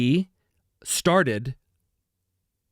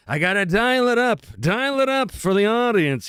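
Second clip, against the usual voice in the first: dialed-up, higher showman energy; energetic; 6 semitones higher than usual. Shown as a demonstration. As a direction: turn up the energy for the audience. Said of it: "I do seem a bit more energetic".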